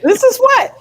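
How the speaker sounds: loud, high-pitched exclamation; exclaiming. Real, not acted. Playful outburst after joke.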